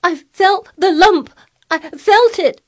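high, loud, tearful wail; choked out. A narrator's reading. Hysterical boy insisting on the lump, choked. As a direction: choke the words out in distress. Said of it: "choked out Colin".

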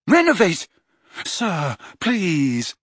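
cast, then beg; Harry trying the spell again; desperate spell cry. A narrator's reading.

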